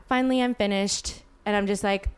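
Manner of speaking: ordinary delivery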